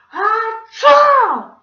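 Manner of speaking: explosive high, very loud "achoo"; big sneeze. A narrator's reading. Mister Gorf lets out a big nose-launching sneeze. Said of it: "let out a big".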